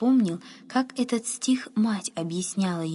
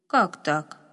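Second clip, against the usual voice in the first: understated puzzled question; mild surprise; 3 semitones lower than usual. A narrator's reading. Groom questions the boy's claim, mildly surprised. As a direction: ask it with a touch of surprise. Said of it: "удивился конюх".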